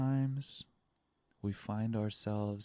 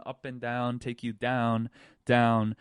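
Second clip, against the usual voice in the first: ordinary talk, nothing marked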